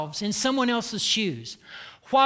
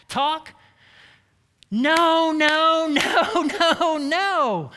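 higher, louder, repeating no with full force; emphatic refusal; 7 semitones higher, 3 dB louder, much slower than usual. Real, not acted. Speaker to the audience rejecting such talk, a loud insistent no. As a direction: hammer each no home, rising in conviction.

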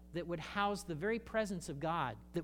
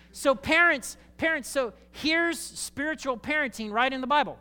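fired-up preaching; 8 semitones higher, 8 dB louder than usual. Real, not acted. Preaching at full force.

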